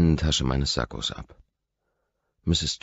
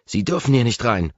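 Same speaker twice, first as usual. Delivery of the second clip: much higher and louder shriek; shrieking like a fury; 9 semitones higher, 7 dB louder than usual. A narrator's reading. Roommate chasing the intruder down the hall, shrieking. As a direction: screech it after him like a fury. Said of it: "kreischend wie eine Furie".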